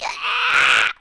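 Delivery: dry, voiceless croaked "ah"; demonstrated failing-voice "ah"; voice giving out. Shown as a demonstration. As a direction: let the voice collapse into a toneless rasp. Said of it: "it starts going like".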